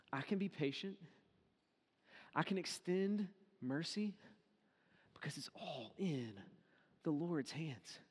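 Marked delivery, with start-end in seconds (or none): 5.1-8.0 hushed, unvoiced whisper. Real, not acted. Preacher reflecting to congregation, whispered. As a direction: drop to a whisper to let it land.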